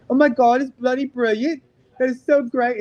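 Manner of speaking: high, loud, delighted English kid impression; mock British kid. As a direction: gush like a thrilled British schoolkid. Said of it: "the English kids".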